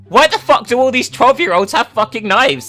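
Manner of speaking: ordinary delivery, nothing marked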